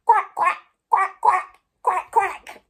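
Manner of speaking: loud, high duck quacks; worm quacking. Acted. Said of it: "said the worm".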